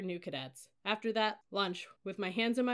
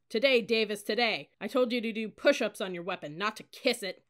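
loud, exasperated reprimand; impatient berating; 2 semitones higher, 7 dB louder than usual. A narrator's reading. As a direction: bark at the dawdling cadet. Cadet leader impatient with cadet.

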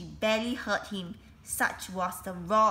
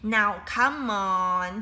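slow, louder, drawn-out command; a weak headmaster forcing authority; slow, strained stern headmaster; authoritative headmaster; 5 dB louder, much slower than usual. A narrator's reading. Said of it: "trying his hardest to sound authoritative".

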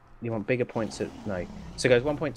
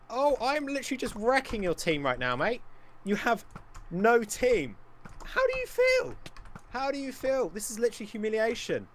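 high-pitched mocking gloat; gloating taunt; 10 semitones higher than usual. Real, not acted. Gamer wrecking the other team, high gloating taunt. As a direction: rub it in, voice pitched way up.

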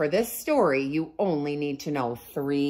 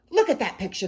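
demanding; 6 semitones higher, faster than usual. A narrator's reading. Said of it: "they demanded".

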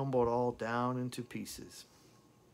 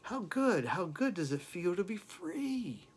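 envious friend asking; 8 semitones higher than usual. Retold or imitated. Prison friends asking the singer how freedom feels. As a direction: tease him about freedom. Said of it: "They ask unto me".

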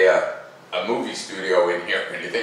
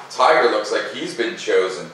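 ordinary delivery, nothing marked

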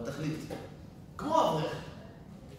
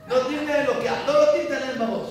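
much higher and loud scoffing; mocking outburst; 9 semitones higher, 9 dB louder than usual. Real, not acted. Preacher scoffing at the scholar's rule, loud mocking. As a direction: throw his rule back with scorn.

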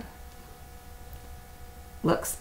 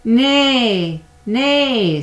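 high, loud neighing nay; whinny the words like a horse; 6 semitones higher, 7 dB louder than usual. Acted. Reading to a child, the horse neighs loudly. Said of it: "said the horse".

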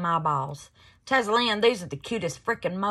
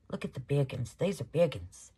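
ordinary talk, nothing marked

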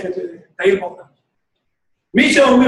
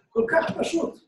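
whispered repeat; 5 semitones higher, 8 dB quieter than usual. Shown as a demonstration. Rabbi whispering to his congregation that Torah is כל כך פשוט.

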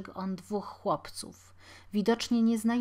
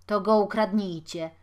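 loud, irritated dismissal; angry retort; 9 dB louder than usual. A narrator's reading. Beggar finishing the quarrel with Jakub, angry. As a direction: end the argument crossly. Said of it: "zakończył dziad z gniewem".

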